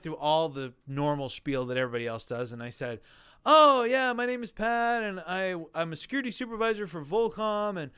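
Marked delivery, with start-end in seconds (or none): 3.4-7.9 mock pitch voice. Contestant rehearsing his spiel for the producer, hammed up and higher. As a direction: ham up the intro like pitching yourself to a producer. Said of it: "You know, I'm doing all that crap".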